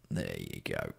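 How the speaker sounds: low, quiet croak; croaky voice. Real, not acted. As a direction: let it drop into a soft creak. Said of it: "I'll do that croaky voice thing".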